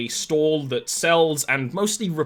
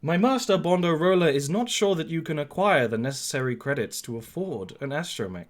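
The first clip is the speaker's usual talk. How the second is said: protocol droid; 3 semitones higher, faster than usual. Acted. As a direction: relay the master's doubts like a servant droid. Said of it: "the protocol droid is like".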